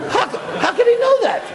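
high, slow, laughing wonder; astonished laughing question; amazed. Real, not acted. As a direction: marvel at the miracle. Teller marvels at the story.

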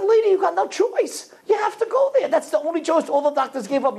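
very high, pleading insistence; emphatic insistence. Retold or imitated. Stranger insisting the mother has no choice, urgently emphatic. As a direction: press the point as the retold stranger.

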